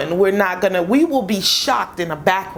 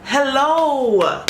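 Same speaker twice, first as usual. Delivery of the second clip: high, sing-song hello to the viewers; high greeting call; 6 semitones higher than usual. Pretend. Greeting her followers in a high calling voice. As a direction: call out to the audience brightly. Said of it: "channel greeting call".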